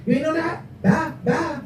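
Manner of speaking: high, soft sassy dismissal; mocking girly voice. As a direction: toss it off like a diva. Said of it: "Before, she like".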